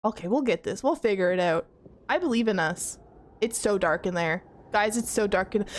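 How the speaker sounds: normal talking voice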